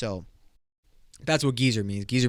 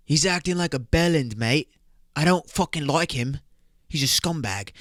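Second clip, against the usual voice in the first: mock British accent; 3 semitones higher than usual. Host shows listeners how to use bellend, mock British accent. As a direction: put on a British lad's accent. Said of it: "some U K slang".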